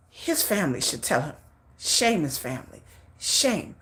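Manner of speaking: lightly imitated elder, softly pronouncing shame; hushed disapproval. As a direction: retell his reproach in a subdued voice. Voices old friend's stern disapproval.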